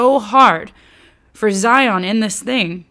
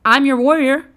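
higher, friendly and eager; eager God; 6 semitones higher than usual. Retold or imitated. God calling Israel to trust him, higher and eager. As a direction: wave them over, keen to help. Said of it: "God all along's like".

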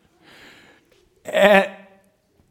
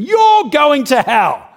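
loud, high, drawn-out street-preacher cry; shouted street-corner condemnation; condemning shout; 11 semitones higher, 6 dB louder, slower than usual. Retold or imitated. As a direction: bellow the verdict at the crowd, stretching it out. Street preacher condemns next person.

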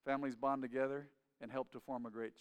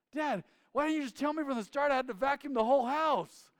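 much higher, louder whining complaint; a child outraged at being tricked into more chores; whiny kid protest; 11 semitones higher, 9 dB louder than usual. Retold or imitated. Lazy son whines at Dad over the chores, high and loud.

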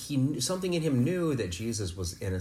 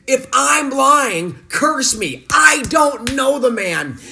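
high, loud swearing shout; Peter's furious oath; 15 dB louder, much slower than usual. Acted. Peter cursing at the bystanders, high furious shout. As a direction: yell the denial like a cornered man swearing. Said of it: "And Peter swore".

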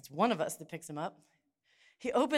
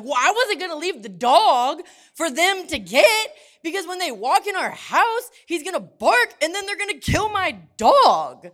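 higher, louder, worked-up defence; indignant outburst; 9 semitones higher, 12 dB louder than usual. Retold or imitated. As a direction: insist it as if obvious. Defending bringing dog, worked up.

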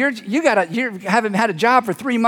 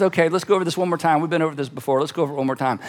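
low, quickly muttered recap, trailing softer; patient repetition; 6 semitones lower, 5 dB quieter, faster than usual. Acted. Jesus to the twelve in a little conference, patiently repeating. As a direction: go over it once more, low and contained.

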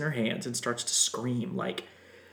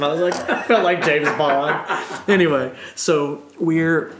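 laughing; 4 semitones higher, 9 dB louder than usual. Real, not acted. Excited scuba diving memory.

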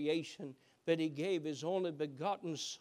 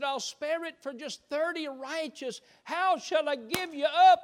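high, much louder cry of anguish; pleading cry; 9 semitones higher, 11 dB louder than usual. Acted. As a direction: build the plea into a loud cry. God bargaining, rising to cry.

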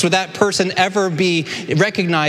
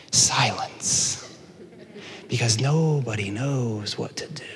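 hushed whisper; 4 semitones lower, 7 dB quieter, slower than usual. Acted. Dramatic pause in the story.

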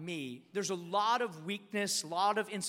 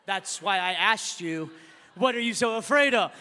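pointed counselor; 9 dB louder than usual. Retold or imitated. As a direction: drive the old question home. Counselor pointedly repeats his question.

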